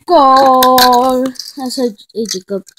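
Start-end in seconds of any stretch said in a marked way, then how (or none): 0.1-1.2 loud, high calls across the court; shouting in a game. Pretend. Kid calling teammates during basketball, shouting high. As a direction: yell for the ball and the goal.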